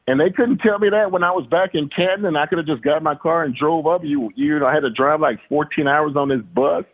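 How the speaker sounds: high-pitched, exasperated mock griping; incredulous complaint. Player griping about the long bus ride, comically exasperated.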